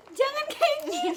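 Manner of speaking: very high, loud begging broken by laughter; laughing mock plea. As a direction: beg dramatically while cracking up. Friend clinging to legs, imitated.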